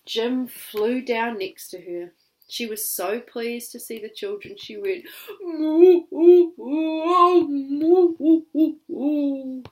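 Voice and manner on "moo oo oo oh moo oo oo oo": high, loud tearful mooing; crying cow. Acted. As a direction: moo and sob with joy. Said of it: "and cried".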